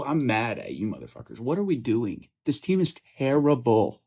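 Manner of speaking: fired-up trash-talk rant; mock angry rant. Real, not acted. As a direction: chew out your buddies, loud and fed up. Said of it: "I'm just gonna go on a rant here".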